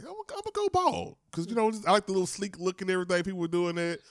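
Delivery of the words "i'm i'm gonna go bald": much higher naive put-on voice; goofy high self-imitation; imitating younger self. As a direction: announce it like a carefree kid. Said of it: "I was like".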